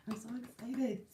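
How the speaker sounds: giddy whisper; excited whisper. Real, not acted. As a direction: hush it like a thrilled secret.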